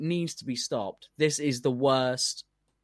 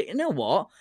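pitched up, mock-amazed self; mock inner voice; 8 semitones higher, 4 dB louder than usual. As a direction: play your past self suddenly won over, higher. Mock realisation, retold inner voice.

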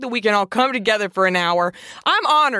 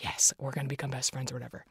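much softer, fast, hushed inner voice; hushed excited aside; 9 semitones lower, 14 dB quieter, faster than usual. Retold or imitated. Answering her comedian friends, softly and fast. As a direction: breathe it out like a secret thrill. Secretly thrilled at friendship invite.

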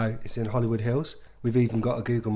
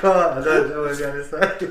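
giggles; 3 semitones higher, 6 dB louder than usual. Real, not acted. Telling co-hosts he can't say it, giggling. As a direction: struggle to speak through laughter. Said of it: "[name]'s got the giggles".

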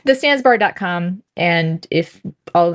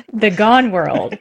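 usual speaking voice

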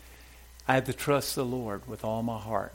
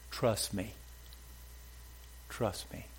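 soft quoting; 2 semitones lower, 11 dB quieter, slower than usual. Retold or imitated. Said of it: "and he said this".

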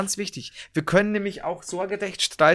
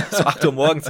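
laughing; 6 dB louder than usual. Real, not acted. Laughing after choking fit.

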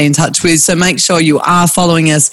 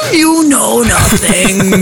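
slow laughing Game of Thrones quote; 5 semitones higher, much slower than usual. Retold or imitated. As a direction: laugh through the famous line. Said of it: "Jon Snow".

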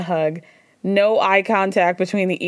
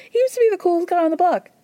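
mocking; 9 semitones higher, faster than usual. Mocking confessional quote.

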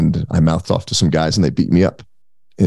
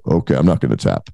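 lower, smug self-assured voice; cocky; 6 semitones lower than usual. Retold or imitated. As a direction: brush off the warning, full of ego. Cocky younger self answering Noah.

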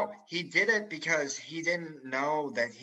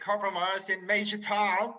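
higher, mock-presidential officer voice; JFK-like officer voice; 7 semitones higher, 3 dB louder than usual. Storyteller voices the officer calling his name. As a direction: lightly voice a stiff officer who sounds like JFK. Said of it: "I sound like J F K".

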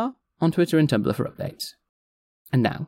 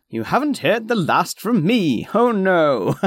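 mock menace; 6 semitones higher, 3 dB louder, slower than usual. Villainous tease of return.

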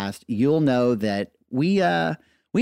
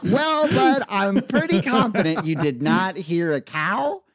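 higher, laughing through kind words; amused gentle correction; 6 semitones higher than usual. Retold or imitated. Dad gently telling his son it wasn't a cow, amused. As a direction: let the laugh leak into it.

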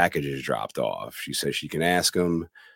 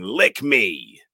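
mock demon yell, louder and higher; a hint of a demon, pitched up and loud; 4 semitones higher, 6 dB louder than usual. Retelling the possessed girl attacking Mother, imitated demon yell. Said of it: "in the demon voice is yelling".

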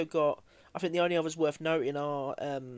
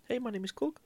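put-on higher, softer New Zealand accent; Korg; 7 semitones higher, 4 dB quieter than usual. Fan imitating his boy Korg for his co-host, New Zealand accent. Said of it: "you can't do a New Zealand accent".

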